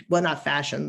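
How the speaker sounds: ordinary talk, nothing marked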